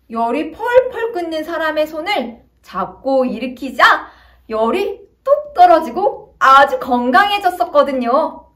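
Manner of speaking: ordinary delivery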